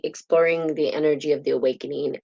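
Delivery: ordinary talk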